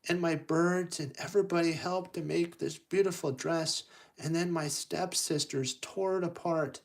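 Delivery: high-voiced heroine pouring out her woes; Cinderella. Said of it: "she, Cinderella goes".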